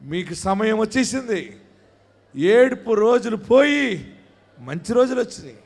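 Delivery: normal talking voice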